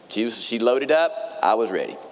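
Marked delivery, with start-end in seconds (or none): none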